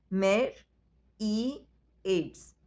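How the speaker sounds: "mer- ई- एड्स" split into three separate beats with pauses; syllable by syllable. Shown as a demonstration. Teacher to pupils, counting syllables of myriads, one beat at a time. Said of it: "myriads के syllable count है".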